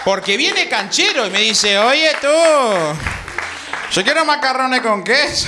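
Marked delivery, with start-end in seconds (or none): 1.8-5.5 a light touch of neutral Latin American dub Spanish, cocky and a bit higher; lightly mocked neutral-Spanish cartoon voice; cocky Disney-dub Spanish. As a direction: mimic a kid who talks like a dubbed cartoon, showing off. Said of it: "todo el tiempo habla así".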